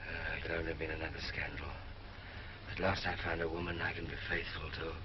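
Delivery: low, soft, level murmur; subdued confiding. Acted. King confiding marriage troubles to his wife, subdued. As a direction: confide it quietly, voice dropped right down.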